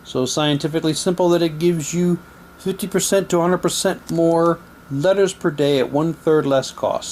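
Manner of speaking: ordinary talk, nothing marked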